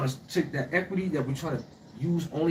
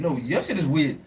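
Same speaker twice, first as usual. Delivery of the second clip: ordinary delivery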